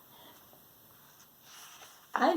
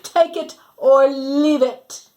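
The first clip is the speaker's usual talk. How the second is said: slow, much louder ultimatum; shouting archduke; 5 semitones higher, 12 dB louder, slower than usual. Acted. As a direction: bark it as an angry archduke. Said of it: "shouted the archduke".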